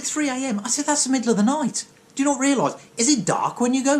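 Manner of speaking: squeaky shocked disbelief at a ridiculous answer; incredulous. Retold or imitated. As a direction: act staggered that anyone runs at 3 a.m. Retold shocked reaction to 3am.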